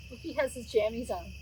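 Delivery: ordinary delivery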